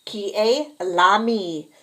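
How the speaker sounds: Qui est l'ami? spoken slowly, each French word clear; slow model phrase. Shown as a demonstration. French teacher models the question slowly. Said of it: "un ami".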